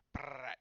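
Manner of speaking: drawn-out trilled brrrr; dive-bomb noise. Acted. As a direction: imitate a whooshing dive with a loud trill. Imitates Superman's dive bomb.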